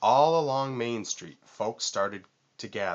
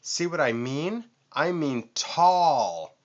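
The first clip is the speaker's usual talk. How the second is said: showing off; 6 semitones higher, 5 dB louder than usual. A narrator's reading. Vulture shows off height.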